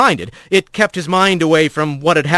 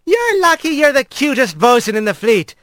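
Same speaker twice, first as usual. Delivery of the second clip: a big otter woman's higher put-on voice, teasing; teasing otter crewwoman; 10 semitones higher than usual. Lally teasing Trek after hugging him, higher teasing voice. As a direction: tease the bosun fondly, pitched up.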